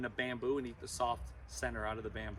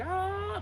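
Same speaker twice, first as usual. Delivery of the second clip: a much higher, mock gasping "Ah"; open-mouthed gasp; 4 dB louder than usual. Keeper mimicking a mouth-breathing lemur for kids, high mock gasp. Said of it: "be like".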